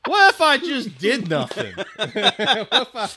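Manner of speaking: high laughing lazy rich man; lazy rich old man. Retold or imitated. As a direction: ham up a lazy old money gent. Mocking an idle rich grandfather.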